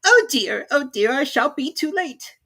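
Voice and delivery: high, fussy rabbit voice in a hurry; flustered little rabbit muttering to itself. A narrator's reading. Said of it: "hear the rabbit say to itself".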